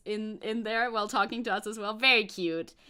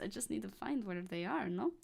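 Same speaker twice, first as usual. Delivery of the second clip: ordinary delivery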